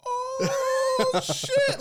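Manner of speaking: very high, loud, long "oh" breaking into laughter; scandalized, delighted howl; shocked hoot. Acted. Kids hearing the oil spill insult, shocked hoot.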